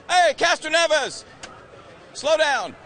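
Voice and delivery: loud, high, slow call across the grid. Real, not acted. Reporter shouting to a driver across the grid, loud call. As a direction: shout to get his attention.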